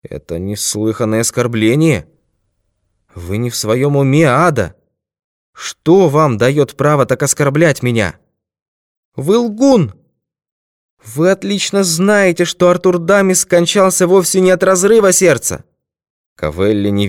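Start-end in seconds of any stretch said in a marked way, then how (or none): 0.0-8.2 offended man, building in pitch; raised, affronted quarrel voice; indignant protest. A narrator's reading. 9.2-15.6 very high, loud woman's outburst; high-pitched, impassioned denunciation; furious accusation. A narrator's reading. Woman accuses him in heated quarrel.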